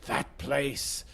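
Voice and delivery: a hushed half-hearted Yoda; quiet Yoda impression, under the breath; imitating Yoda. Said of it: "and Yoda said".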